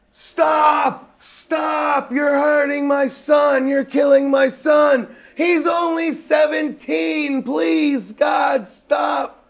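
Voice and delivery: high, pleading father's shout; re-enacted parent's panicked, high-pitched yell; imitated frantic yelling. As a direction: yell desperately, begging him to stop. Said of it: "father yelling at New Jack".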